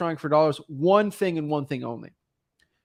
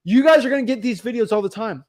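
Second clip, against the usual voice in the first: ordinary delivery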